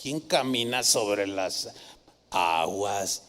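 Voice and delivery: the preacher mimicking skeptics, low and scornful; mocking skeptic. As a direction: sneer it like a know-it-all skeptic. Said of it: "los que explican todo dirían".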